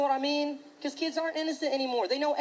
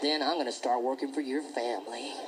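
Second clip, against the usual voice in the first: menacing bully; 12 semitones lower than usual. Retold or imitated. Bully on the bus to another kid, low and menacing. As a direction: growl it low like a creepy little psycho.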